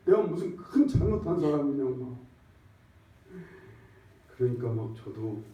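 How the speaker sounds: ordinary talk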